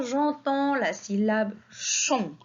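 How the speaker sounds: ordinary talk, nothing marked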